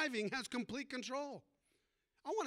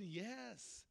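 soft yes; 10 dB quieter than usual. Acted. Jesus answers in story.

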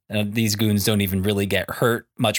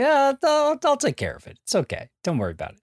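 casual Superman; faster than usual. Retold or imitated. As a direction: brush off the bullets like it's nothing, squeaky high and breezy. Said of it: "kinda having a casual conversation".

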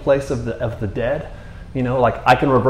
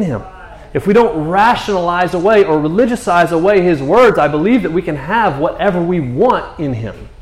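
raised, urgent sermon delivery; impassioned preaching; 4 semitones higher than usual. Real, not acted. Preacher urges congregation to believe, impassioned preaching. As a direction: press the conviction home, line after line.

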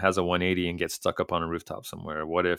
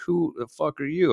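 mock confrontation; 10 semitones higher than usual. Manager confronting intruder.

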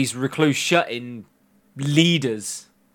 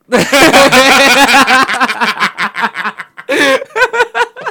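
evil laugh; 8 semitones higher, 7 dB louder than usual. Pretend. Villain bit, loud cackle.

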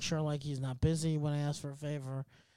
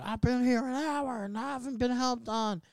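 imitated complaining yell; 8 semitones higher than usual. Retelling the ER patient's complaint, imitated high yell. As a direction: imitate an angry patient hollering from next door. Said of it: "he was yelling 'cause he's like".

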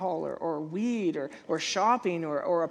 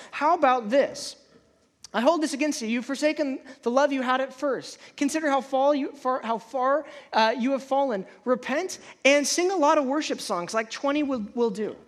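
louder, higher, lightly hammed-up God; mock God voice; 6 semitones higher, 5 dB louder than usual. God giving a wishful concrete command, hammed up. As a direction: voice God as if giving easy orders. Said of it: "It's like".

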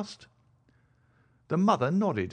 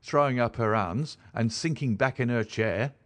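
ordinary delivery, nothing marked

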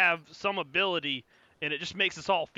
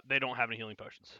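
ordinary talk

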